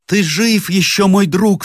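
high, loud cry of relief; joyful cry. A narrator's reading. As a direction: cry out with joy that his friend lives. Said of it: "вскричал секундант француза". (радостный громкий вскрик)

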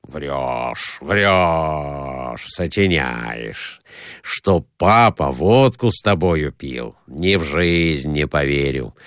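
low, drawn-out disbelief; disbelieving Nikolai Ivanovich. A narrator's reading. Man calling his companion's dream a lie, disbelieving. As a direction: scoff slowly in a low man's voice. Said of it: "воскликнул Николай Иванович".

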